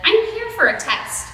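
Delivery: put-on high, bright child voice; squeaky little boy voice. Boy voiced in read-aloud story.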